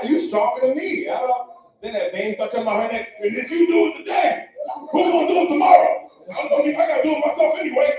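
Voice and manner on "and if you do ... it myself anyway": loud, higher mock rant; mock angry girlfriend shout; angry woman shouting. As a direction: yell it like a fed-up girlfriend. Acted angry woman quote.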